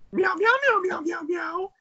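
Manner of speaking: very high, loud falsetto meowing; scary cat puppet. Acted. Host mimicking the show's cat puppet, shrill falsetto cat voice. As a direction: squeal meows like a puppet cat. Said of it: "the scary cat puppet on there".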